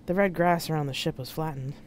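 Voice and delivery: usual speaking voice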